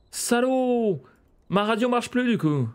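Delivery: high-pitched outraged yell; indignant cursing. Real, not acted. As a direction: curse the culprit and wail about the damage. Gamer curses the intruder, radio broken.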